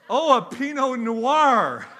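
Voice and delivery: slow, loud, high, laughing through it; exaggerated correction. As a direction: sell the punchline with a laugh. Retelling exchange with country man.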